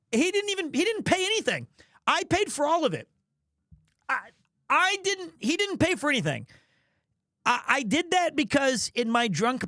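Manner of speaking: high-pitched protest; outraged, high self-justification; indignant defence. Acted. Indignantly defending himself to laughing audience.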